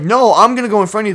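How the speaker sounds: mock his stubborn insistence. Retelling Zach refusing to let them go ahead.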